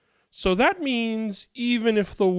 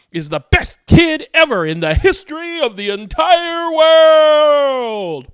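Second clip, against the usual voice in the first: proud shout; 11 semitones higher, 9 dB louder than usual. Acted. Praising the child loudly.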